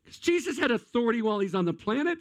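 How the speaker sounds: ordinary talk, nothing marked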